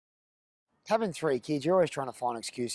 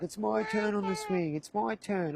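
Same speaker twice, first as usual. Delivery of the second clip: put-on whinging kid; 8 semitones higher, 4 dB quieter than usual. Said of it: "they're always whinging".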